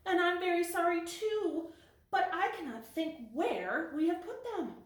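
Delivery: high little kitten voice, mildly sad; a touch of sorrow in a small high voice. Acted. Whitey apologising to her sister, high and mildly sad. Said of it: "said Whitey sadly".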